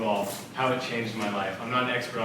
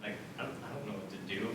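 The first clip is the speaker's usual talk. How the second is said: low, very soft and unsure; small helpless mumble, barely audible; quiet, lost child; 5 semitones lower, 15 dB quieter, faster than usual. Acted. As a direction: murmur it like a stumped five-year-old. Acting out his five-year-old self at a loss.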